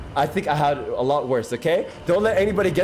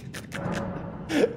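high breathless giggle; 11 semitones higher, 8 dB quieter than usual. Real, not acted. Streamer laughing at chat.

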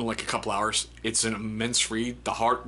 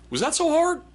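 high, louder sarcastic quip; mocking cop; 5 dB louder than usual. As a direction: toss it off like a smug cop. Cop's quip retold.

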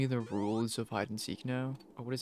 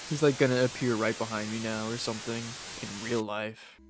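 ordinary delivery

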